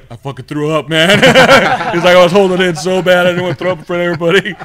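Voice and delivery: high, loud, laughing protest; worked-up retold shout; loud excuse. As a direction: blurt out an embarrassed excuse over laughter.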